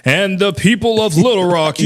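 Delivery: high, loud stock newscaster read; news anchor voice. Retold or imitated. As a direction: ham up a stiff TV anchor. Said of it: "news anchor voice on".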